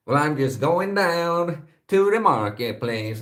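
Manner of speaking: high, slow southern drawl; southern accent attempt. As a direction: put on a stretched-out Dallas accent. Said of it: "some kind of southern accent I tried to do".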